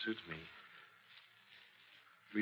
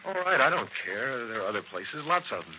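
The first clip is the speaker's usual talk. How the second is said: eager insistence; 5 semitones higher, 7 dB louder than usual. Acted. Eager plea to travel.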